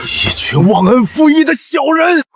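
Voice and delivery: slow, high-pitched shout of outrage; furious curse. Acted. Official curses his ungrateful backers in fury. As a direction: spit out the insult, high and drawn out.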